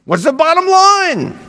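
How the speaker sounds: high, impatient demand; impatient husband. Pretend. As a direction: hurry her to the point. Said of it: "the husband wants, maybe wants to say".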